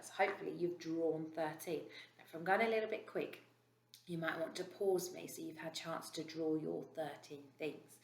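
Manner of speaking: normal talking voice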